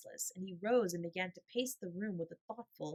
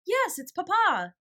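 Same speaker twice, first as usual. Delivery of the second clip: much higher, louder, slow announcing; proclaiming boy; 12 semitones higher, 12 dB louder, slower than usual. A narrator's reading. Little Donald proclaiming his father's arrival, higher and louder. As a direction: announce it to everyone, slowly. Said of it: "Donald proclaimed".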